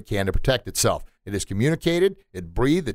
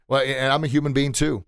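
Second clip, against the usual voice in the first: ordinary talk, nothing marked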